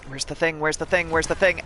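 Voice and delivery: frantic searching. Real, not acted.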